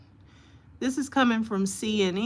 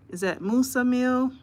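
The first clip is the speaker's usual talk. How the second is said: usual speaking voice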